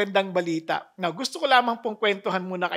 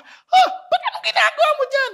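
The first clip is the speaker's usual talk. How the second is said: alarmed father; 8 dB louder than usual. Retold or imitated. Father alarmed at child inside.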